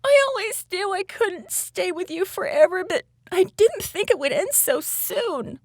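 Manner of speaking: put-on high, upset little-girl voice; lightly acted sobbing in a high pitch. A narrator's reading. Little girl facing goodbye to her orca friend, mildly sobbed. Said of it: "Orion sobbed".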